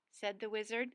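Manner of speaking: ordinary delivery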